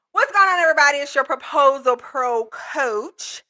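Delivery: ordinary talk, nothing marked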